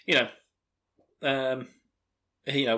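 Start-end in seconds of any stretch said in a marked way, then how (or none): none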